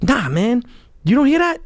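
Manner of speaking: can't believe he doesn't hear it; alarmed insistence. Retold or imitated. Insisting he hears incoming.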